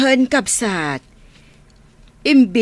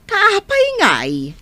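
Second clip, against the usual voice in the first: much higher, bewildered cry; puzzled surprise; 9 semitones higher than usual. Acted. Sister baffled that the workers keep it secret, higher in surprise. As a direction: react as if it makes no sense.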